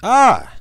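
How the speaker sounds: high loud burst of Ah; sudden yelp. Real, not acted. Host starting a new story, with a loud high yelp. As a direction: yelp out to grab attention.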